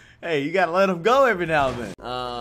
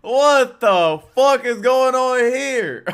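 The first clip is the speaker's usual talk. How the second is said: laughing disbelief; 6 semitones higher, 6 dB louder, much slower than usual. Real, not acted.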